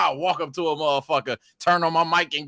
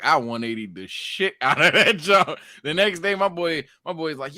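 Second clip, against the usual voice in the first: ordinary delivery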